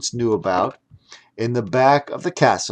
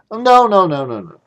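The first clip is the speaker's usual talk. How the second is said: emphatic denial; 7 semitones higher, 6 dB louder than usual. Acted. Girl denies emphatically.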